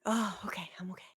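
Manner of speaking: breathy whisper, holding back a sneeze. Real, not acted. Streamer fighting a sneeze, whispering.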